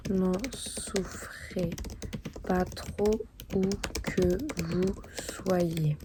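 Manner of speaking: slow, halting words with long pauses; dictating while writing. Real, not acted. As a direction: say each phrase as you write it down. Composes letter aloud while writing it.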